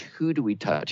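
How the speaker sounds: ordinary talk, nothing marked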